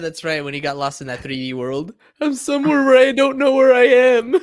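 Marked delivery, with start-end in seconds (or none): 2.2-4.4 high, loud laughing Homer quote; Homer Simpson impression. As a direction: belt it out like Homer, cracking up. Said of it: "That was Homer Simpson".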